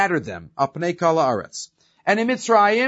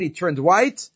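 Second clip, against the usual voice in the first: usual speaking voice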